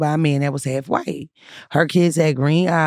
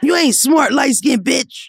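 hammed-up bully yelling the insult; mock bully taunt; 7 semitones higher, slower than usual. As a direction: yell it like a bully picking a fight. Mocking school bullies' taunt.

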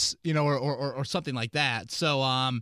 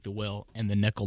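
normal talking voice

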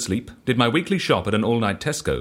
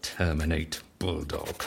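softer and slow, saying it as he writes; murmured note-taking; 6 semitones lower, 8 dB quieter, slower than usual. Acted. Writing down the other man's wish, quiet slow murmur. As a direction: mutter it to yourself while jotting it down. Repeats the item while writing it down.

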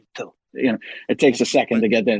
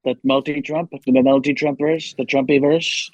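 Donald Trump. Acted. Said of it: "back to Trump".

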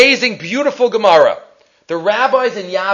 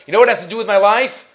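normal talking voice

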